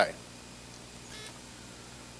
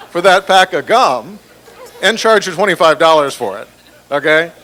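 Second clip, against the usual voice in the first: laughing; 3 semitones higher, 11 dB louder than usual. Real, not acted. Laughing while mocking bank fees.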